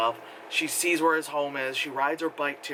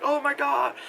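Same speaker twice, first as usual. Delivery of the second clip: mock gasp; 10 semitones higher, 3 dB louder than usual. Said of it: "and it's like".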